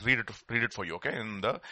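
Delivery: usual speaking voice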